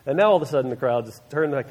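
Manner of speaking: ordinary talk, nothing marked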